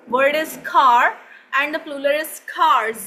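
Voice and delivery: cars said slowly, ending in a buzzing z; z sound plural. Shown as a demonstration. Teacher showing students the plural: cars ending in a z sound. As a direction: stretch out cars and end it on a voiced z. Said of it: "यहाँ पर जी sound आया है कार्स में".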